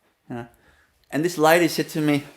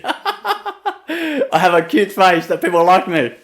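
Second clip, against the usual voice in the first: laughing; 2 semitones higher, 4 dB louder, much slower than usual. Real, not acted. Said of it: "laughing while saying it".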